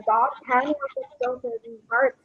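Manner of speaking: usual speaking voice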